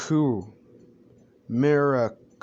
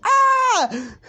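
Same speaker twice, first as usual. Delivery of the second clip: very high, loud shriek; women-like scream; 6 dB louder than usual. Acted. Bakugo terrified at seeing his mom, women-like scream. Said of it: "lets out a women-like scream".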